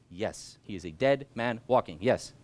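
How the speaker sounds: usual speaking voice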